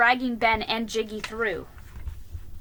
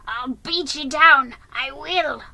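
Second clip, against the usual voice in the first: loud, raised threat to a horse; angry yell; 5 semitones higher, 9 dB louder than usual. A narrator's reading. Jiggy threatening the horse he is roping, angry yell. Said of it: "yelled Jiggy".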